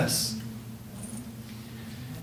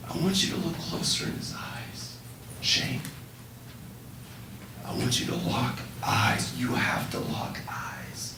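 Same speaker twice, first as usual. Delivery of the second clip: softer, breathy guided-imagery voice; hushed guiding whisper; 5 semitones lower, 9 dB quieter than usual. Retold or imitated. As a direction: lead her gently into the picture.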